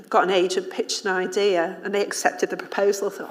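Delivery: ordinary delivery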